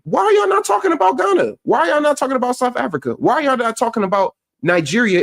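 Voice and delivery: high-pitched, insistent questioning; indignant challenge. Real, not acted. Vlogger confronting Pan-Africans over their silence, indignant. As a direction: throw each question out high, demanding answers.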